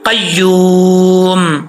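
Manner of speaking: कय्यूम drawn out, the मीम held silent at the stop; pausal qayyum. Shown as a demonstration. Teacher showing the stop on कय्यूम, slow and drawn out. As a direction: end कय्यूम on a closed, unvowelled म. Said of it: "वक्फ करेंगे तो मीम साकिन".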